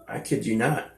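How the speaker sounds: normal talking voice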